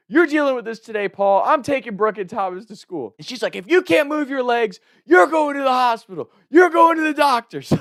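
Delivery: high, loud angry orders; furious mom. Retold or imitated. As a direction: lay down the law like a mom who's lost it. Mom losing it at dad.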